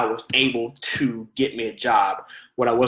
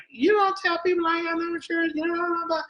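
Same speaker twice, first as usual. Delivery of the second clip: much higher, fast, huffy mock outrage; mock indignant outburst; faster than usual. His old self answering the clerk, fast high mock indignation. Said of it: "the old [name] would have been like".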